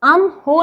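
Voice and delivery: "अम हो" as two words with the h sounded; wrong split reading. Shown as a demonstration. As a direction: break it into "अम" and "हो". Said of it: "नहीं पढ़ेंगे".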